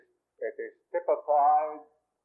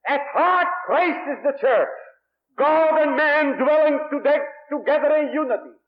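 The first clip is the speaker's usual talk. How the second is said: fiery preaching; 12 semitones higher, 8 dB louder than usual. Acted. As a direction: thunder it out from the pulpit. Preaching climax on the church.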